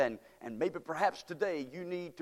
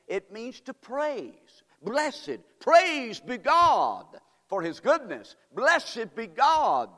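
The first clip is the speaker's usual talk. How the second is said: high, slow, loud exaltation; preaching at full force; 7 semitones higher, 6 dB louder, much slower than usual. Acted. Preacher explaining blessed, loud slow and high. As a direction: lift the praise up.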